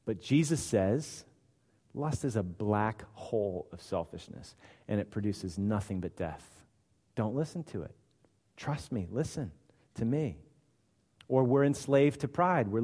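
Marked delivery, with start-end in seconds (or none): 1.9-10.4 much softer, low earnest plea; quiet warning. Acted. Jesus warning against lust, soft low plea. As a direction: draw them close and warn.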